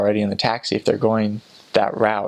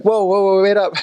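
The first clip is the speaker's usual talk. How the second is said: high, loud "wait up" call; 10 semitones higher, 5 dB louder than usual. Retold or imitated. Missionary to a fast member, loud high call. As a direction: shout after someone racing ahead.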